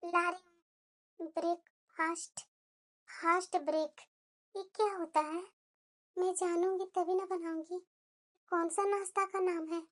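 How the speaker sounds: soft, low talk to yourself; hushed, confused aside; puzzled muttering. Acted. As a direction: mutter it quietly, trying to work it out. Puzzling alone over an English word.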